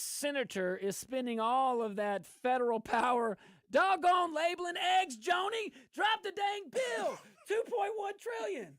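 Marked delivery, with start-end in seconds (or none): 3.7-8.7 high, loud comic outburst; exasperated mock yell. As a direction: holler at the senator in mock outrage, half laughing. Mock outburst at senator, laughing.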